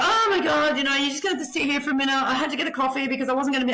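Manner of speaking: high, fast, breathless excuses of a late therapist; flustered therapist. Retold or imitated. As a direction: rattle it off like you rushed in with a coffee. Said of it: "then comes in flustered".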